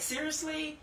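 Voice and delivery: a mock eye-roll "Seriously?"; sarcastic. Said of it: "a sense of sarcasm".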